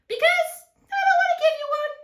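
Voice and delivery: very high, rapid squeak; lizard voice. Acted. Lizard snubbing grumpy cactus, high and fast. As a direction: squeak the rejection quickly.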